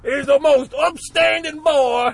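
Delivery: loud, high hammed-up Southern orator; Al Pacino Southern accent. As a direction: shout it like a Southern general. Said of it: "leghorn Southern accent".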